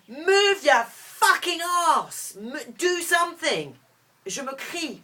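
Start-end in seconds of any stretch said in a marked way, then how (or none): 0.1-3.7 high, very loud, drawn-out yell; screaming at oneself. Retold or imitated. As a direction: yell it slowly at full force, pitch leaping up. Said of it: "je hurle à moi-même". (hurler sur soi-même)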